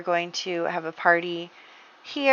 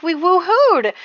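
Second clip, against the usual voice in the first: indignant insistence; 11 semitones higher, 6 dB louder, slower than usual. Acted. [name] insists.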